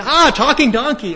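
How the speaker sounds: very high, loud mock scream of alarm; startled shriek. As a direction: shriek as if the donkey just spoke. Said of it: "same sort of thing with Balaam".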